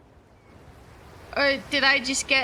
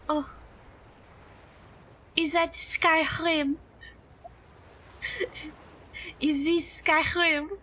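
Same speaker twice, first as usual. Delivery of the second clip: hammed-up, mock French accent; horrible French accent. Streamer playing up a horrible accent redeem for chat, hammed-up French accent. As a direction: put on a deliberately bad French accent. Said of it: "It wouldn't be French".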